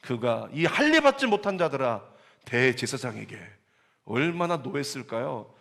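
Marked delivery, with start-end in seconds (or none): 0.5-2.0 bold rebuke. Retold or imitated. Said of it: "집사 스데반이 뭐라고요?".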